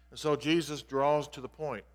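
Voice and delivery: ordinary talk, nothing marked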